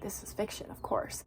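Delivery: whispered to herself, half under the breath; a soft murmured reassurance to self; hushed aside. Retold or imitated. Fallon reassures herself about the book in a hushed murmur.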